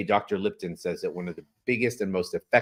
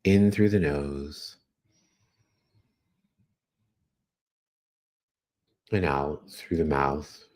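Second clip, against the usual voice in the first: guided meditation; 6 semitones lower than usual. Acted. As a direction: guide them gently through each breath. Leads breathing meditation.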